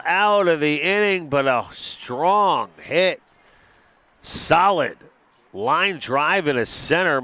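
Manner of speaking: loud, high excited home-run call; excited play-by-play. Real, not acted. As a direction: sell the big hit. Big hit called live.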